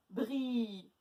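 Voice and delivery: BRI with a long held i; stretched syllable. Shown as a demonstration. Teacher checking the middle syllable for A, stretched. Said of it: "Où est-ce qu'on entend le A?".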